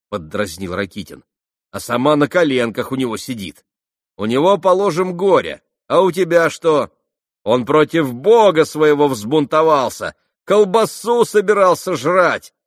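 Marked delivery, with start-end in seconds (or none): 1.7-12.5 high, loud jeering voice; sneering young man, loud and high; mocking Rakitin. A narrator's reading. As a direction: tease her loudly, almost yelling.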